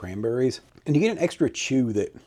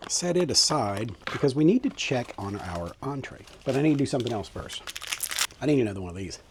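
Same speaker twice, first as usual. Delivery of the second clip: ordinary delivery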